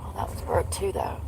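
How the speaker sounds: low unvoiced whisper. Real, not acted. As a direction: whisper it to yourself. Muttering at broken pump.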